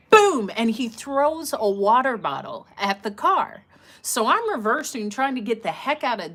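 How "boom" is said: loud imitated impact boom. Telling how the bottle hit the car, a loud boom. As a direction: slam the word like a hit.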